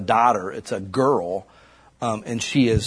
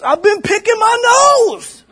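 much higher, louder, slow put-on voice; high mock voice admitting nose picking; old man's voice; 10 dB louder, slower than usual. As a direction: drag it out like the odd old man. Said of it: "he goes".

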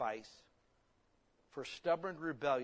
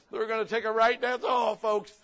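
shouted through laughter, much louder and higher; loud laughing punchline; 8 semitones higher, 11 dB louder than usual. Real, not acted. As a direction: belt the punchline out while laughing. Laughing joke about pigs' fate.

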